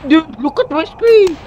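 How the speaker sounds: high, loud and slow, urging friends to look; incredulous exclamation. Real, not acted. Urging friends to see a glitch.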